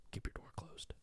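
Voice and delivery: whispered warning into the mic; giggly prank whisper. Pretend. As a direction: hush it like a spooky secret. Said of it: "I'll just keep whispering into the mic".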